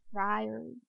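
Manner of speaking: hushed, rising Friary; soft repeat. Shown as a demonstration. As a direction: murmur Friary to yourself with relish. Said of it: "one of my new favorite words".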